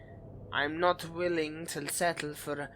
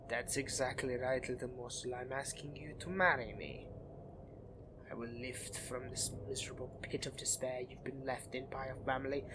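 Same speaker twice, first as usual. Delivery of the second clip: much softer, close and tender proposal; soft, intimate seductive murmur; intimate low murmur; 4 semitones lower, 10 dB quieter than usual. Acted.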